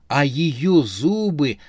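ordinary delivery

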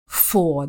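British FOR with no R at the end; silent final R. Shown as a demonstration. Giving her own practice word from the teacher, silent R. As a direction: say FOR as a long open vowel, dropping the R. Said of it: "Учитель посоветовал выписать два-три слова".

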